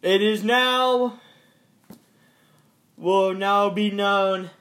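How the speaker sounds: loud, high mock announcement; news anchor voice.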